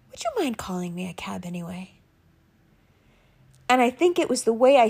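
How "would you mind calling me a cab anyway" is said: fast, strained whisper; desperate whisper. Retold or imitated. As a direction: whisper it, close to cracking. Desperate plea to rental guy.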